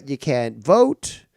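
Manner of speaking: normal talking voice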